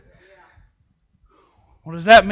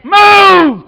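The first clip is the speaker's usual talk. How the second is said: shouted command; 11 semitones higher, 10 dB louder than usual. Shown as a demonstration. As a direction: Move shouted out loud, falling. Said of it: "Quit sitting in one place".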